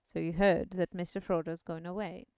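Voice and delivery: low wizard voice; a lightly voiced old wizard; old man voice. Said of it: "old man voice".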